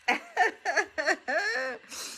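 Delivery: high, soft laugh through emotion; teary laugh. Real, not acted. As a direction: laugh it off while welling up. Laughing through near tears.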